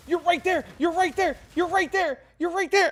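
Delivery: imitating a crying kid, high and loud; son crying. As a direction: wail it over and over like a frustrated child near tears. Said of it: "he's crying because he's like".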